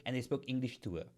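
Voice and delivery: ordinary talk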